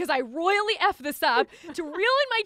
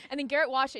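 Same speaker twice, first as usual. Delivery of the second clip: usual speaking voice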